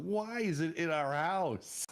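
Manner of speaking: high, drawn-out protest; acting out an incredulous complaint; mock-distressed, stretching the question; exasperated disbelief. Retold confrontation with roommate.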